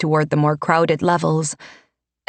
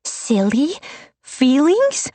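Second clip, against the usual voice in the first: high, rising disbelief; incredulous; 9 semitones higher, slower than usual. A narrator's reading. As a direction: echo it back in shock. Echoing an insult in disbelief.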